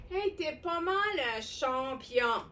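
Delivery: ordinary talk, nothing marked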